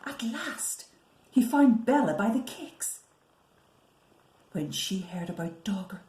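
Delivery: usual speaking voice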